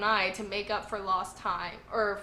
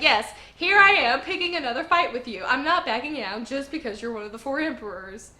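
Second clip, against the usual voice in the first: higher, louder burst at first, then easing; defiant challenge; 4 semitones higher, 8 dB louder than usual. Retold or imitated. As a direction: throw down the challenge as a feisty hero. Acting out Luffy's defiant challenge.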